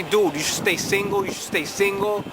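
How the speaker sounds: ordinary talk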